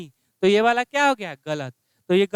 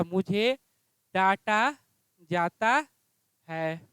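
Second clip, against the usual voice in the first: slow dictation; 4 semitones lower, 4 dB quieter, slower than usual. Shown as a demonstration. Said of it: "क्या हो जाएगा?".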